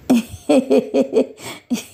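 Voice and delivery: gloating villain's chuckle; evil cackle. Acted. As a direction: laugh slyly over your loot. Villain gloats over stolen idol.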